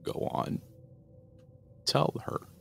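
voiceless rasping urge; urging demon. Acted. Demon urging the daughter to confess, raspy urging. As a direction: press her to confess. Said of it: "he urged".

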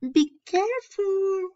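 high, loud cry of alarm; yelled warning. Acted. Boy crying out as his friend loses balance, high and loud. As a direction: shout it as he slips. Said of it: "I yelled".

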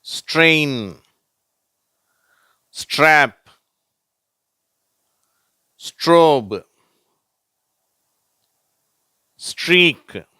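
each str word said loud with pauses; teacher reading str words for the class; model words strain, strap, strobe, streak. Shown as a demonstration. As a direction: read out ஸ்ட்ரெயின், ஸ்ட்ராப், ஸ்ட்ரோப், ஸ்ட்ரீக். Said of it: "வார்த்தைகள்".

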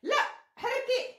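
too loud, headphones on. Real, not acted. Shouted instruction over headphones.

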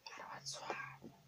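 very soft, voiceless whisper; hushed aside to yourself; whisper to oneself. Acted. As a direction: whisper it privately, barely audible. Murmurs to self as monologue ends.

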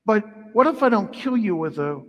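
ordinary talk

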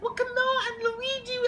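tiny high-pitched helper voice; squeaky sibling. Acted. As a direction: chirp at Luigi to come on. Squeaky sibling calls Luigi.

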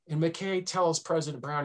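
ordinary talk, nothing marked

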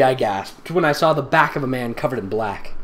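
ordinary talk

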